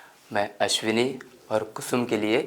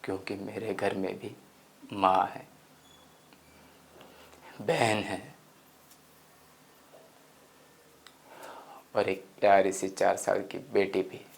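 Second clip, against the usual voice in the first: soft, tender admission; hushed confession; 7 dB quieter than usual. Acted. Narrator explains he has mother, sister and daughter, hushed. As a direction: drop to a quiet, heartfelt tone. Explains fear for his family.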